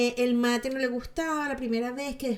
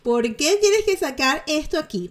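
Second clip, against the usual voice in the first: louder, slow, incredulous question; exasperated; 5 semitones higher, 7 dB louder than usual. Real, not acted. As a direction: ask it as if fed up with her showing off. Exasperated rhetorical question at celebrity. (pregunta exasperada, más fuerte y lenta)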